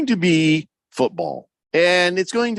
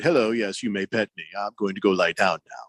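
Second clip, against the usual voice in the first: lightly voiced snobby dog, lower; a hint of a dignified, snooty pet; snooty cat; 7 semitones lower than usual. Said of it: "very cool and collected, very dignified".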